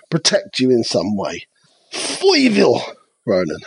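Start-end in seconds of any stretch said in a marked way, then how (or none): 1.9-3.6 Foyville said with a high New York twang; a playful New York accent on Foyville; mock New York accent. Host teases co-host with a playful New York Foyville. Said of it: "it makes me think of a New York accent".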